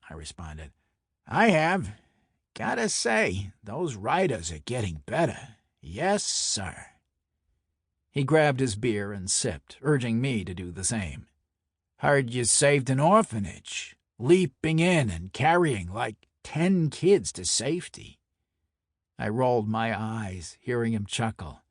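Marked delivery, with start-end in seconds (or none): 1.3-6.9 pleased Brooklyn mobster, pitch jumping up; chummy Brooklyn enforcer; Brooklyn mob enforcer. Acted. 12.0-18.2 amused mob-boss needling; teasing Brooklyn enforcer. Acted.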